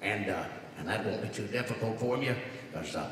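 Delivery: put-on deadpan like an old vaudevillian; George Burns as God. God handing Moses commandments, old comic rasp. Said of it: "George Burns".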